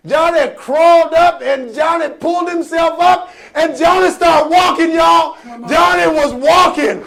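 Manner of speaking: high, loud, chanting sermon shout; full-force preaching. Acted. Preacher rallies crowd as Johnny rocks free, shouting. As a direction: work the crowd up to a roaring climax.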